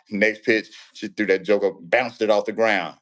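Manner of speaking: ordinary delivery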